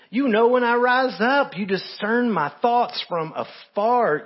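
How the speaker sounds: high, loud, impassioned psalm reading; preaching at full force. Acted. Preacher proclaims David's psalm to the church, impassioned. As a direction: lift the psalm as a cry of wonder.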